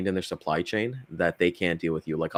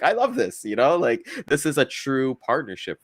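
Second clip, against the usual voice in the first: delighted exclamation; 5 semitones higher than usual. Retold or imitated. Delighted at team partnership.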